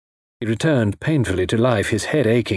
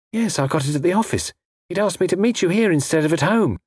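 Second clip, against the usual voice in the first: higher-pitched woman's voice; earnest young woman; 9 semitones higher than usual. A narrator's reading. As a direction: explain earnestly as Frida's higher voice. Frida explains the note.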